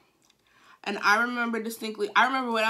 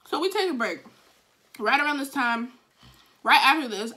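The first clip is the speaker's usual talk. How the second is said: ordinary talk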